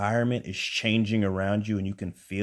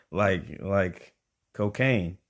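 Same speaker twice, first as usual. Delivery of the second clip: slow spell-out; much slower than usual. Retold or imitated. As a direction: drag out cocaine, slow and pointed. Spelling it out for Mike.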